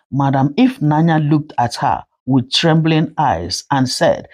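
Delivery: ordinary delivery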